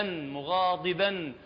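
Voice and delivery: slow مغاضبا with ض halfway between د and ط; مغاضبا ببطء بضاد بين الدال والطاء. Shown as a demonstration. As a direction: draw out مغاضبا, blending د and ط in the ض. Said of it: "يجمع بين الطاء والتاء ... أو الدال وياها".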